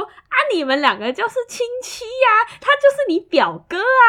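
略带主管口吻的高声宣布; 笑着高声点破关系; a hint of amused boss. Boss exclaims they're related. (主管笑着高声说两人是亲戚)

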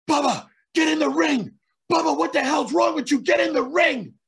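high, loud urgent shout; re-enacted full-voiced yelling. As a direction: yell at a dazed partner to get moving. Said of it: "Why is Devon yelling at me?".

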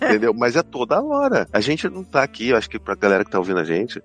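usual speaking voice

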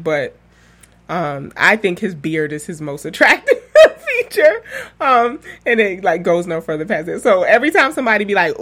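usual speaking voice

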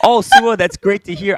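high, bright pretend delight; mock cheerful congratulations. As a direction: gush the way he should have. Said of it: "I should have been happy for you".

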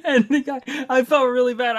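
high, loud laughter through the words; can't hold the laugh in; bursting out laughing. Real, not acted. Dad laughing at his son's blunt line. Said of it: "I just started to bust out laughing".

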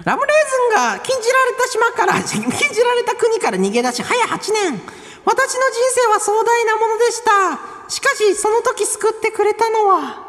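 high, mildly hammed-up recitation; grandiose testimonial. Host gives an example thank-you mail, high theatrical reading. Acts out a grandiose sample letter.

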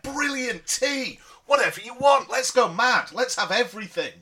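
hammed-up Matt Smith. Podcaster mocking Matt Smith's manic enthusiasm, hammed-up excited. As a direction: gush like a manic, overeager host. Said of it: "And he'd be like".

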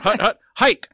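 loud high barked snap call; quarterback calling cadence. Pretend. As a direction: shout the snap count on the line. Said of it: "that should be how I start my set".

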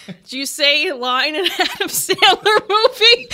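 laughing retold question. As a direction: ask it through laughter. Laughing while asking him.

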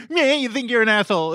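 quick, high mocking echo; mocking comeback. Bully parroting the kid's insult back, mocking. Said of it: "it would be".

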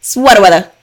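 punchy mimicked skit delivery, dropped r's; skit-style catchphrase. Shown as a demonstration. Showing listeners how she says it, mock skit voice. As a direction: say it like the comedy skit. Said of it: "that's how I say it".

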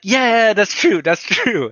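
high, slow acted backing-down; mock-meek, drawn-out agreement; sheepish agreement. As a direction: play a fan hastily conceding the point. Acted sheepish reply to rebuff.

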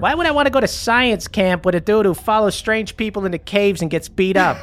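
put-on high, bratty teenage kid talking back; mouthy teen. Teen kid talks back to his mom, high and bratty.

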